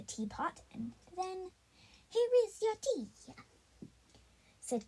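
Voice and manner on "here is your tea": high, loud hammed-up Italian accent; mock Italian accent. Carrot serves tea in a hammed-up Italian accent. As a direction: ham up a bad Italian accent. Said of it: "supposed to be an Italian accent".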